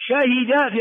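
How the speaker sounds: شهد drawn out, kasra on the ha; original verb form. Shown as a demonstration. Teacher modelling the past-tense verb, "shahida". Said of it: "فعل ماضي".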